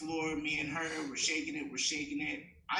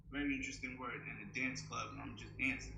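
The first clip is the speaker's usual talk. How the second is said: ordinary talk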